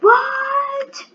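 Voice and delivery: high, drawn-out mock "what?"; mock stunned yell. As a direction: imitate a long, incredulous high shout. Imitating another figure's stunned yell.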